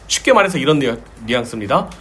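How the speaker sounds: ordinary delivery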